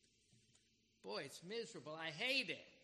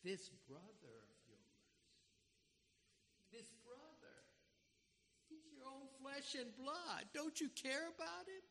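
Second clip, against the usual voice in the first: pleading "This brother of yours" softly, pitch climbing; wrong version, pleading; 9 semitones higher, 7 dB quieter than usual. Pretend. Father pleading softly with his resentful older son. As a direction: beg the older son to care, rising and hushed. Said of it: "He didn't say it like that".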